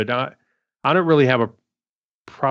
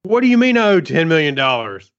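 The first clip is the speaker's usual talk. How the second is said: higher-pitched, hammed-up disbelief; mock outrage; 6 semitones higher, 3 dB louder than usual. Joking about an AI-prepared tax bill, mock outrage. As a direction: play shocked at a huge bill.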